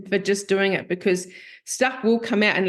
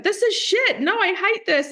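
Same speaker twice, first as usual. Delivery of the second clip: much higher mock-distressed whine; frustrated whine; 11 semitones higher than usual. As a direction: whine it like a fed-up client. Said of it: "And I'd be like".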